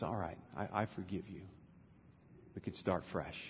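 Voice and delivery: low, very soft acted reply; quietly relent, keeping the pitch down; subdued forgiveness. Woman forgives the parrot pulled from the freezer, hushed and relenting.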